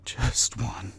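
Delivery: whispered near tears; tearful whisper. Acted. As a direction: whisper it holding back tears. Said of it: "the tears welling in his eyes".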